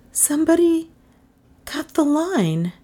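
high, halting woman's voice; alarmed. A narrator's reading. Melissa realising the line is dead, high alarm. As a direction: break it with a pause, pitched high.

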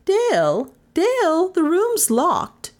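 high-pitched, drawn-out alarmed cry. A narrator's reading. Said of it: "cried Miss Cornelia".

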